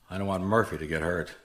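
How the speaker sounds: ordinary talk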